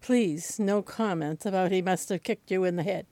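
ordinary talk, nothing marked

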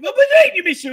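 sudden high, loud protest; indignant outburst. Real, not acted. Host reacts to a viewer's message, indignant outburst.

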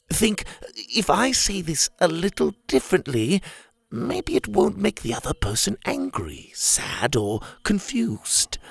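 high, patient gentleman; calm polite lecturing tone; prim orangutan voice. Acted. Leopold's voice.

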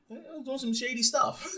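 laughing nervously through the words; awkward giggle while confessing. Real, not acted. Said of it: "that's a nervous laugh".

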